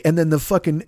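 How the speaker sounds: ordinary delivery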